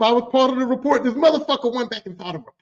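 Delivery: ordinary talk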